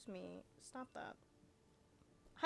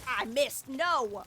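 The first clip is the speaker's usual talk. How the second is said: loud, frustrated yell at a miss; frustrated shout; 11 dB louder, much slower than usual. Real, not acted. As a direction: yell it out after missing. Missed shot, frustrated outburst.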